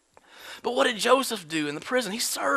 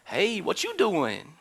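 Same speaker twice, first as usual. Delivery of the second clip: a hint of a slow, lazy Joey pickup line; 3 semitones lower, 4 dB quieter, slower than usual. Potiphar's wife coming on to Joseph, in a light Joey voice. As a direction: toss it off like a lazy flirt. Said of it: "She just turned into Joey".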